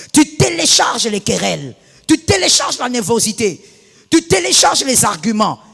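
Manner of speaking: loud emphatic sermon chant; preaching at full force. Acted. Pastor hammering the point at her church, loud preaching. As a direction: drive each line home to the congregation.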